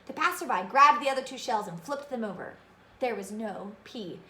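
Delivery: plain reading voice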